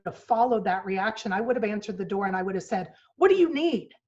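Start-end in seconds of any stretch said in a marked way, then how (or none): 3.1-3.9 higher, louder curt demand; irritated snap. Shown as a demonstration. Mom answering her little girl's knock, curt and irritated. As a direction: bite the question off impatiently. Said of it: "that's the tone".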